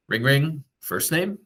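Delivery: name rising sharply high at the end like a question; upward intonation. Shown as a demonstration. Agent phoning a contact, name on a high rising tune. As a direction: lift the name up into a hopeful question. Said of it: "with that upward intonation".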